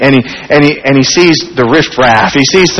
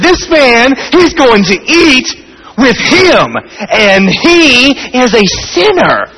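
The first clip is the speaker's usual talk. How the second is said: grumbling complaint; 7 semitones higher, slower than usual. Retold or imitated. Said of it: "They grumbled".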